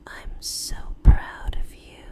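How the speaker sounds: barely-there loving whisper; faint tender murmur. Acted. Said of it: "let the faintest murmur drift".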